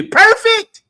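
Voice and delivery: high, loud burst of praise; exclaimed. Real, not acted. Preacher marvels at Lucifer's beauty, exclaiming high and loud. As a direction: cry it out, thrilled.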